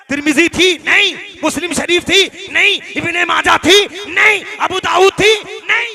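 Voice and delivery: loud, high rhetorical question and answer; rousing call-and-answer at full volume; shouted challenge. Real, not acted. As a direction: fire off questions and shout each denial.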